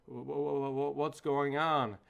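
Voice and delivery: mock dazed stammer, slow; stammering, slow, retold daze; groggy coming-to. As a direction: imitate someone waking up confused, stumbling on the first word. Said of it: "Mike slowly starts coming to".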